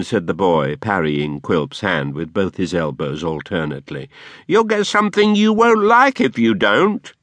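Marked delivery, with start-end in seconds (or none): 4.5-7.1 put-on much higher, louder boy threatening back; boy threatening his master back; defiant boy. A narrator's reading. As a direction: warn him off cheekily.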